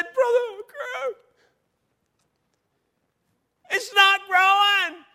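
high, slow, sobbing imitation; retelling her tearful news in a high wailing voice; imitating crying. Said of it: "she's crying like a baby".

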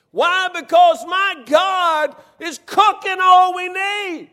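slow, loud, high shout of praise; preaching shout. Real, not acted. Preacher shouting God's provision to the congregation, slow loud shout. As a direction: belt it out to the congregation slowly.

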